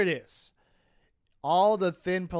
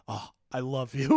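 1 mock infatuated Nick; 2 semitones lower than usual. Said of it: "And he's like".

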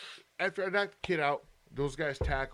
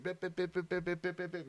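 rapid monotone bip-bip chatter, softer; mock trash talk; 6 dB quieter than usual. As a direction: imitate someone yapping in nonsense syllables. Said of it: "you know, talking shit".

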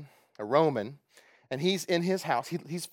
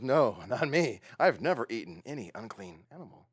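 lower, put-on whiny refusal; mock him as a sulky stubborn man; mock whiny Peter; 5 semitones lower than usual. Preacher mocking Peter's stubborn refusal, put-on sulky voice.

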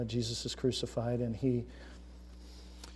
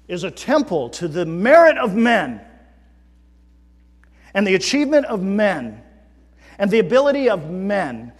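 loud, high fervent preaching; preaching at full force; 8 semitones higher, 15 dB louder than usual. Acted. Preacher pressing the congregation on what church is, full force. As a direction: thunder it out to the congregation.

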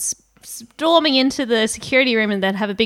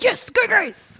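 cheering; 8 semitones higher, much slower than usual. Retold or imitated. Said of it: "he was like".

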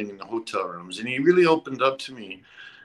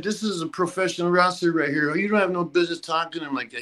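higher, mock-angry defence; scolding her; 6 semitones higher than usual. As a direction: put her in her place, pitched up. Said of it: "He just exploded on her".

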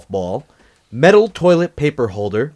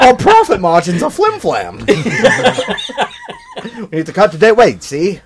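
high, loud gangster-era talk; old-timey voice; 9 semitones higher, 7 dB louder than usual. Pretend. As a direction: talk like a 1920s boss. Joins old-timey bit.

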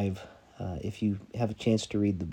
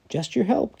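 high, raised reply; simple answer from below; 11 semitones higher, 6 dB louder than usual. Acted. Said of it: "came the answer".

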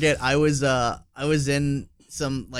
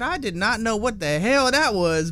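higher mock-baffled voice; realtor quote; 8 semitones higher, 3 dB louder than usual. Retelling the realtor's words, higher mock bafflement. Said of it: "He said".

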